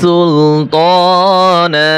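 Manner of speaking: সুলতানা with the last আলিফ drawn out slowly at the stop; long final alif. Shown as a demonstration. Teacher showing students how সুলতানা ends at a pause, drawn out. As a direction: stretch the final "না" of সুলতানা to one alif length. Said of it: "এক আলিফ টানিয়া পড়িতে হয়".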